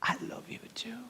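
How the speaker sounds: barely voiced whisper answer; tearful whisper. [name] whispering his love back to God while crying. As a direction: whisper it through tears. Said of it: "Me puse a llorar y le dije".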